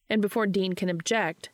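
ordinary delivery

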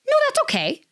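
very high, sweet people-pleaser voice; overly nice brush-off. Retold or imitated. To the barista, declining the fix in a very high, sweet voice. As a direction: chirp it brightly to avoid any fuss. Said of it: "I said, like".

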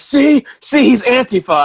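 high, loud gotcha voice; mocking excited accusers. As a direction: imitate people pouncing on proof. Mimics people crowing over proof.

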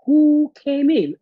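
sudden loud high alarm, half-acted; startled cry. Alone in the dark room, hearing footsteps: loud startled cry. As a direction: jolt up loud and high at an intruder. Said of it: "So I was like".

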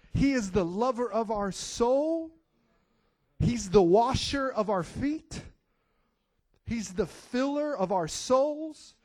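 fervent rising preacher cadence; preaching. Real, not acted. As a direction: proclaim each line like a litany. Preaches a litany of praise.

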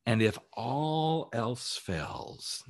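very slow, drawn-out lead-in; slow dramatic pause. Shown as a demonstration. Coach building up to his final advice, slow and drawn-out. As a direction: stretch each word for suspense. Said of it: "coach slows for emphasis".

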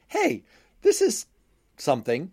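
sudden high "hey, this is"; surprised. Retold or imitated. Finding an old savings bond.

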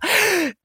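sharp, high intake of breath; audible breath before speaking on; demonstrated inhale. Retold or imitated. Guest acts out Jesus inhaling before his next sentence, audible inhale. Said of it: "literally he takes an inhale".

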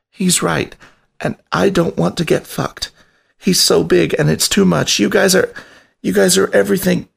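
high, small rat voice giving up; a timid rat voice, resigned and pleading; defeated drawl. A narrator's reading. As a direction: sound beaten and overwhelmed. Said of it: "a drawl of defeat in his voice".

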